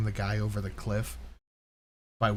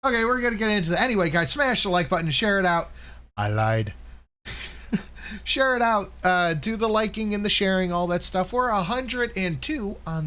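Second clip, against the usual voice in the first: higher, louder YouTuber pitch; hyped vlogger plug; 9 semitones higher, 3 dB louder than usual. Acted. Host hyping viewers to like and share. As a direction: sell the like button like an announcer.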